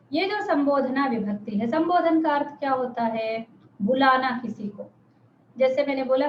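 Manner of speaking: ordinary talk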